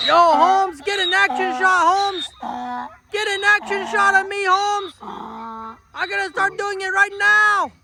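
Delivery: high cocky dude voice for the horse; voicing the horse. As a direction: speak for the horse, high and swaggering. Owner voices horse Peluche.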